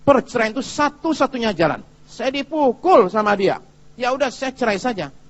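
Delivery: acted-out wife, high and emphatic; distressed wife. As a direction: imitate a wife pleading her case to the pastor. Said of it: "istrinya mengatakan gini, datang ke saya".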